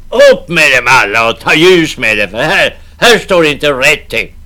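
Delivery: loud urgent call for light. Acted. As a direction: shout for the candle. Navvy calling for light.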